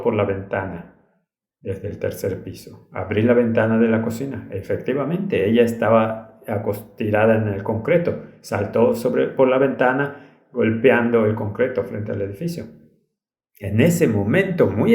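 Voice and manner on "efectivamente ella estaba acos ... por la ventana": ordinary delivery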